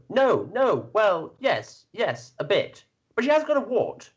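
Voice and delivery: high, bumbling villager excuse; peasant crowd voice. Acted. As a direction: backpedal like a caught peasant. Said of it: "And the crowd will go".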